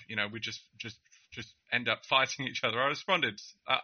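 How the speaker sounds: ordinary talk, nothing marked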